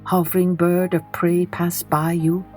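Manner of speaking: plain reading voice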